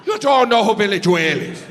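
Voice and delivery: Irish lilt, "Joel" said jwail, shocked; lightly Irish outrage at ignorance; Irish disbelief. Retold or imitated. Irish sound guy stunned by American comic, mild Irish disbelief. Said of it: "And he goes".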